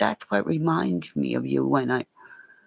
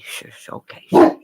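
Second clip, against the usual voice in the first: hushing; 10 dB louder than usual. Real, not acted. Hushing the barking dog.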